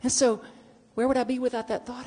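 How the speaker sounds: ordinary delivery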